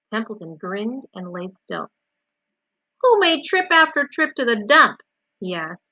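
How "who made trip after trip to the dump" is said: high, loud rhetorical question; indignant boast. A narrator's reading. Templeton lists his services to Wilbur, indignant. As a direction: demand credit loudly.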